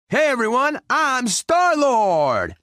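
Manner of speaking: high, drawn-out hero announcement; triumphant shout. Acted. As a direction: shout the name out long and proud. Announces himself to everyone.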